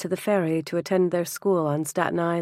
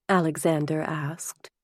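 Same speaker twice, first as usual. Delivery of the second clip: ordinary delivery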